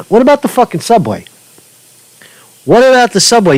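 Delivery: high, incredulous outburst; indignant. Real, not acted. Angry rant at governor.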